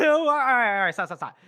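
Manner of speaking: acted panicky pleading, high and loud at first; mock panic. Hedges begs the counting corporal to stop, panicky. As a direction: burst out high, then tumble into pleading.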